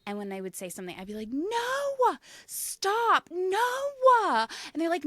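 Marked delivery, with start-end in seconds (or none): 1.3-4.5 put-on high, loud, whiny-kid Midwestern "no-ah"; exaggerated Midwestern accent. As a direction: stretch it out in a hammed-up Midwestern accent. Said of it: "very exaggerated Midwestern accent".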